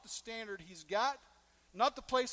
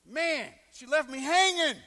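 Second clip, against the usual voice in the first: higher and much louder wail of dismay; hammed-up lovesick despair; mock despair; 7 semitones higher, 12 dB louder than usual. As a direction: cry out in comic heartbreak. Dismay at being left hanging.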